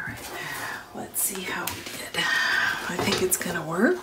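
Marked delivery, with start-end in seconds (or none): 0.1-2.2 hushed whisper. Real, not acted. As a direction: whisper it while concentrating. Whispering while working.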